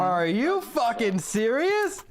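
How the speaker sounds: slow, high disbelieving outrage; indignant disbelief. Real, not acted. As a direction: draw it out in disbelief. Indignant at teammate.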